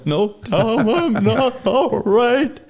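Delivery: high, drawn-out comic whimper; slow, high-pitched pretend blubbering; mock tearful wail. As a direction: admit it in a hammed-up, high crying voice. Acted admission, close to crying, laughed at.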